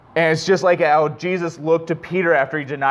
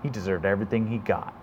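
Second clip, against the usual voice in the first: much softer, a hushed inner voice; spiteful inner voice; 7 semitones lower, 11 dB quieter than usual. Retold or imitated. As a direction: murmur it like a voice you shouldn't listen to. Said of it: "I could have listened to my, you know".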